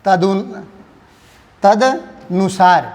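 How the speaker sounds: तदनुसार drawn out syllable by syllable, slowly; slow spelled-out. Shown as a demonstration. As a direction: sound out तदनुसार slowly for students. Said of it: "तो ये क्या हो जाएगा?". (छात्रों को समझाते हुए तदनुसार धीरे-धीरे, अक्षर-अक्षर बोलना)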